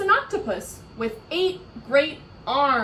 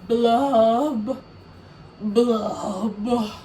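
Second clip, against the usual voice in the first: loud drawn-out blubs; fish blubs; 7 dB louder, much slower than usual. Acted. Fish ending his refrain, loud blubs. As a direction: blub like a sad fish. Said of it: "Oh, here we go".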